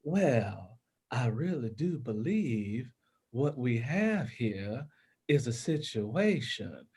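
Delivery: slow, elongated Carolina drawl, softened; South Carolina accent. Shown as a demonstration. Narrator showing how Carolinians elongate their words, slow South Carolina accent. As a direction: stretch every word out in a South Carolina drawl. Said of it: "people have a tendency to elongate their words".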